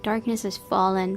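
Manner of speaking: ordinary talk, nothing marked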